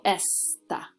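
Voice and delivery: "esta" drawn out on ES, light "ta"; stress on first syllable. Shown as a demonstration. Teacher isolating the word for students, ES stressed in esta. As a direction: lean on ES in "esta", then drop "ta". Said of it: "emphasis is gonna go on that first syllable".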